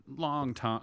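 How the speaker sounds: ordinary talk, nothing marked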